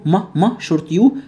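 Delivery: usual speaking voice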